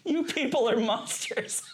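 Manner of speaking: high, drawn-out mock outrage through laughter; imitated appalled performer. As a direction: scold the audience as a horrified improviser. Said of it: "And they're like".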